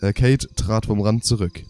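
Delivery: ordinary delivery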